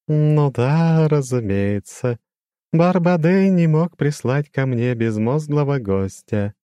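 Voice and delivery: high, slow, ringing demon voice; eerie chiming demon, slow and lofty; crystal ringing voice. A narrator's reading. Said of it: "прозвенел демон своим хрустальным голосом". (высокий звенящий хрустальный голос демона)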